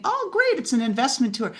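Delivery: sudden high-pitched mock glee; mock delight. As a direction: play the naive hopeful founder, thrilled. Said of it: "You think".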